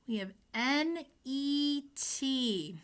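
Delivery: slow letter-by-letter spelling; spelled out. Shown as a demonstration. Teacher spelling for young readers. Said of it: "let's sound it out".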